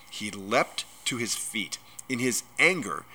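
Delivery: usual speaking voice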